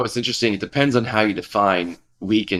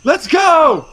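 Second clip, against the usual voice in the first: high, bright enthusiastic yes; eager agreement; much slower than usual. Retold or imitated. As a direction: jump on board eagerly. Cheerful follower agreeing.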